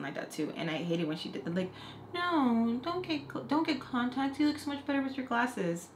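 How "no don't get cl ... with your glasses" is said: fake-nice cousin. Cousin slyly discouraging contacts, high mock-sweet voice. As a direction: imitate a shady relative pretending to be nice. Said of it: "you low-key being shady".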